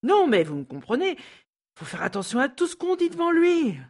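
insisting she's understood, voice climbing; agitated. Acted. Maid pleading with Mrs Snell to understand, high and agitated. Sandra's anxious outburst.

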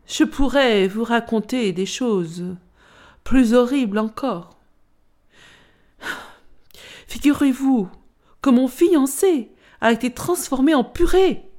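potato matching the horror story; dramatic potato. Acted. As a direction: lean in with a darker tale.

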